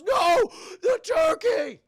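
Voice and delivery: high, drawn-out scream of horror; horrified yell. Retold or imitated. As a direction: howl over a ruined dinner. Old man sees dogs ruin turkey.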